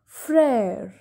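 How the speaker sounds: Frère as a long "frehr", falling; accent grave pronunciation. Shown as a demonstration. Teacher giving an example of accent grave, Frère as "frehr". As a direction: model Frère with open "eh", "frehr". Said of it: "has the sound of A".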